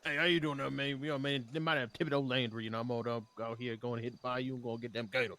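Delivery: put-on a loose Cajun accent, lightly done. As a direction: play a swamp man with a Cajun accent. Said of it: "stop doing the accent".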